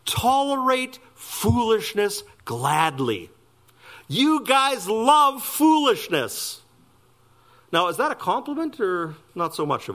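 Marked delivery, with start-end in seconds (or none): 0.0-3.3 slow, high, pointed sarcasm; sarcastic. Acted. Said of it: "notices a little sarcastic here". 4.1-6.6 high, drawn-out mocking jab; sarcastic. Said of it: "notices a little sarcastic here".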